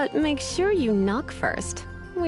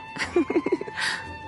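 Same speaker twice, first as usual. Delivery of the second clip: high, breathy giggle; coy chuckle; 6 semitones higher, 3 dB quieter than usual. Acted. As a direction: chuckle lightly to yourself, coyly. Coy about being a humble librarian.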